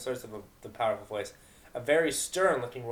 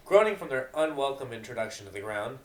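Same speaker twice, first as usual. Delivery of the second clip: ordinary delivery, nothing marked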